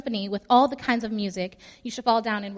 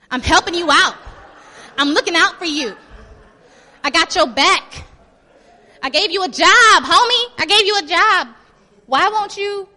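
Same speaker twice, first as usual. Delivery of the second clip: loud, high, indignant shouting; acted outrage at full volume; angry king; 7 semitones higher, 14 dB louder than usual. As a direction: yell like a benefactor who's been snubbed. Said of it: "Be angry, be angry".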